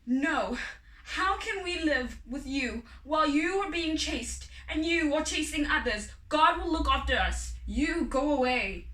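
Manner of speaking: loud, firm mother sending him away; mother bird firmly rejecting her husband; firm refusal. Acted. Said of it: "never seen Mama sound so firm".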